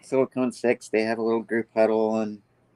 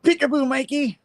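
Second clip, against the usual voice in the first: high, sing-song taunting call; spring out and tease him; sing-song ambush. Retold or imitated. Mysterio ambushing Peter out of nowhere, high sing-song taunt. Mysterio bursts in mid-scene.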